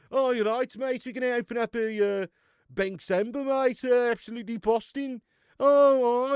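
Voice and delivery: loud, high mock Wolverhampton accent. Local inviting a mate to the pub, exaggerated Wolverhampton accent. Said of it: "Wolver fucking Hampton".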